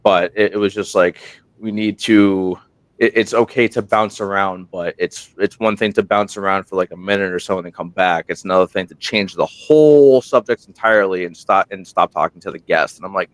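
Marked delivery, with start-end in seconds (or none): none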